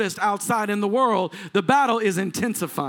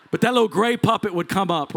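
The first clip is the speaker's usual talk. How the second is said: ordinary talk, nothing marked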